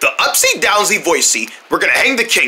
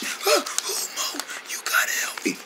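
faint whispered plea; lost voice; 12 semitones higher, 12 dB quieter than usual. Pretend. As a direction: beg for help with barely any sound. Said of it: "you lost your voice".

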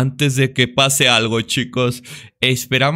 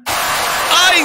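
sudden high startled shriek; scared shriek; 4 dB louder than usual. Real, not acted. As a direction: yelp from a real fright. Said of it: "¡Qué susto me acaba de dar".

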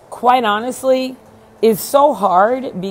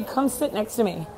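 ordinary talk, nothing marked

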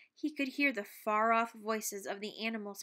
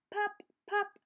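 popping sound; 10 semitones higher than usual. A narrator's reading. Imitating popping.

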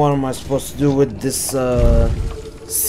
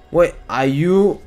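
ordinary talk, nothing marked

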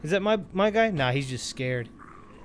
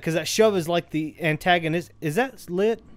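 ordinary delivery

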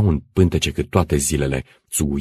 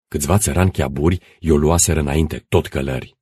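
usual speaking voice

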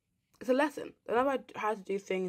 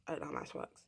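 whispered aside; 9 dB quieter, much faster than usual. Real, not acted. Self-mocking aside about dates.

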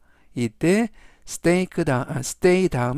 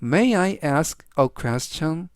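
very slow, each word separated; slow, clear reading; much slower than usual. Shown as a demonstration. Teacher slowly reads the sentence three times for students. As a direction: read slowly so students can follow. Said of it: "제가 역시 천천히 세 번씩 읽어드리고요".

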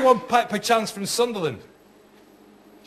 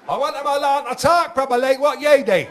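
broad Geordie dad; 7 semitones higher than usual. Retold or imitated. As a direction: ring up in thick Geordie, pitched way up. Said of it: "he phoned up this elocution school, he said".

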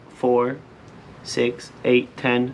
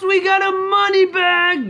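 real whoop of triumph; excited shout. Real, not acted. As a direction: shout it high like you just won. Celebrating a lottery ticket win.

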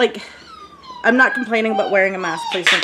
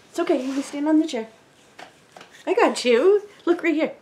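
higher, gentle coaxing voice; 3 semitones higher, 3 dB quieter than usual. Real, not acted. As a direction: coax a little one kindly. Mom coaxing toddler onto chair.

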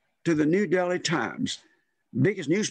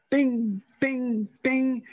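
high, light "bing, bing, bing" like a shuttlecock being hit; ping it out like a shuttlecock popping off a racket; imitated shuttlecock pings; 8 semitones higher, much slower than usual. Imitates shuttlecock sound he hears.

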